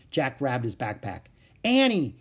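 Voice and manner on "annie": high, loud call of her name; urgent cry. A narrator's reading. Jack to Annie among the wildebeest, urgent cry. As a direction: cry out to get attention. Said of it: "he cried".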